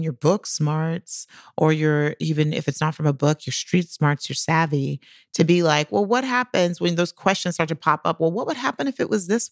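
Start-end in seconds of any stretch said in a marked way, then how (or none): none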